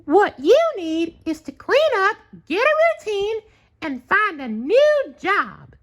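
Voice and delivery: very high, loud alarmed voice; Sandy exclaiming. A narrator's reading. Sandy shocked at SpongeBob's state, very high exclaiming voice.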